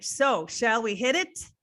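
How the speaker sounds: ordinary delivery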